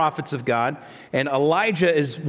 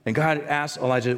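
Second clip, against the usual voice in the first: normal talking voice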